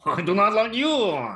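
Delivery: a haughty Quebecer in a put-on Quebec French accent; mock Quebec French accent. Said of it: "that uppity Quebec accent".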